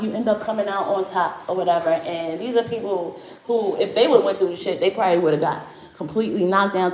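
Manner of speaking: rushing fast through the words; hurried, racing delivery; talking too fast. Real, not acted. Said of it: "I'm talking too fast".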